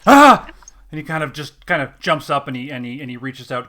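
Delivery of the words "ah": sudden loud, high cry of fright; short startled scream; startled yelp. Acted. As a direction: yelp as if shaken out of a trance. Startled priest shaken by player.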